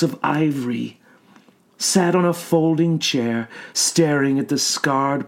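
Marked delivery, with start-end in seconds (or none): none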